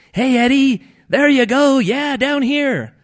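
high, loud little shrimp shouts; calling up. A narrator's reading. Shrimp shout up to Ed from the bucket, calling out. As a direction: holler up in a squeaky-high shrimp voice. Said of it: "the shrimp calling up from him".

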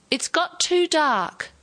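loud. A narrator's reading. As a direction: call it out to the whole table. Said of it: "she said loudly".

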